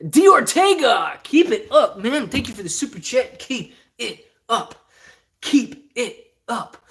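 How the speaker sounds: high, slow hammed-up chat voice; put-on voice reading super chat. Streamer thanking a viewer for his super chat, mock hype voice. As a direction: draw it out, high and silly.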